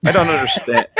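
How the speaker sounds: reading while cracking up; laughing through the line. Acted. Reader breaking into laughter mid-quote. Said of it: "I pr".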